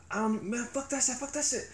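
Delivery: high-pitched mock outburst; acted protest. Someone mouthing off before mom's slap, high-pitched mock protest.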